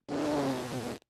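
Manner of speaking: cheeks squeezing air out through the lips; puffed-cheek breath squeezed out as a demo; mechanical exhale demo. Shown as a demonstration. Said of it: "this is mechanically pushing that out".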